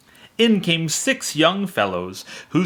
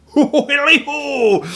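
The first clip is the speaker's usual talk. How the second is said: high, much louder jolly whoop; Fezziwig crying out; 14 semitones higher, 7 dB louder than usual. A narrator's reading. Fezziwig cheering the shutters done, jolly whoop. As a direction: whoop it out with glee. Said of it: "cried old Fezziwig".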